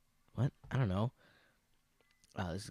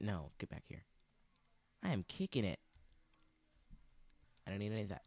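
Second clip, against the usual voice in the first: ordinary delivery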